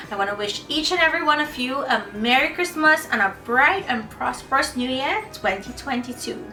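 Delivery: ordinary delivery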